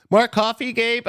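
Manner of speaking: high loud call out for coffee. Real, not acted. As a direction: shout it out, teasing.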